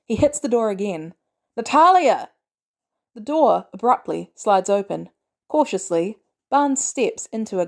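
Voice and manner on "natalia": high, loud yell of a name; frustrated shout. A narrator's reading. Barnes hits the door again, shouting for Natalia. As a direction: yell through the door, exasperated. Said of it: "he shouts".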